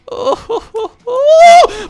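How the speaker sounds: high shouting cries of terror; terrified cries. Real, not acted. Nearly dying to the boss, crying out in real terror. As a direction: cry out in pure panic. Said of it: "my heart genuinely cries out".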